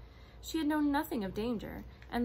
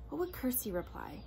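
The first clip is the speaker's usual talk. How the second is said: ordinary delivery, nothing marked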